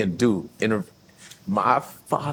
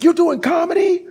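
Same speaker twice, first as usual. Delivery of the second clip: shocked shout. Retold or imitated. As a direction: blurt it out in disbelief. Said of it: "I was like".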